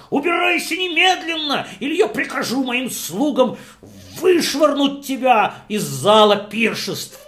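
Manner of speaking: tremble with rage and shout him down; angry host yelling. Acted. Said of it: "и он закричал".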